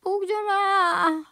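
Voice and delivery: a hammed-up last request; faint mock farewell. Grandma's fading mock farewell. (힘없이 부탁하는 할머니)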